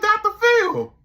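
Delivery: very high and loud; shocked cry. Retold or imitated. Dave seeing the baby looks like Doctor Phil, shocked shriek. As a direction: cry the name out in horror. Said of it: "He like".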